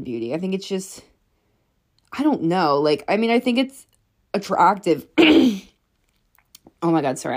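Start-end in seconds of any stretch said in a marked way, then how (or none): 5.1-5.6 sudden choking throat clear. Real, not acted. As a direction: cough it out, caught off guard. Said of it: "I'm, like, choking on nothing".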